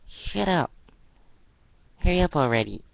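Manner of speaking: high, loud angry snap. Acted. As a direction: stomp and hurry her. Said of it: "stomped the floor in anger".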